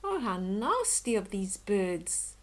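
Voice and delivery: ordinary delivery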